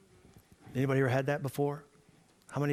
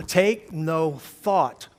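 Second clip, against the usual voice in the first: "Take no thought" loud and very slow; emphatic reading; 2 semitones higher, 9 dB louder, much slower than usual. Real, not acted. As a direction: punch out each word of "Take no thought". Rereads verse after regaining attention.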